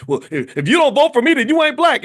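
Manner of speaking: high, loud mock outburst; imitating an angry politician yelling; blowing up. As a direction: shout it out like he lost his temper. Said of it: "he blew up and said".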